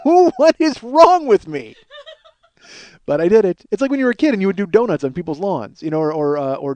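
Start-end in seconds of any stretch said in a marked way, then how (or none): 0.0-1.8 high, loud, slow laughing question; laughing disbelief at himself. Real, not acted.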